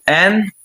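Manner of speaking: એન as a separate piece of environment; sounded out. Shown as a demonstration. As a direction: say એન alone, as if dictating. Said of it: "બોલતા બોલતા લખવાનું". (એન છૂટું પાડીને, લખાવતા હોય તેમ)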